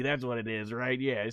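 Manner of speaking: normal talking voice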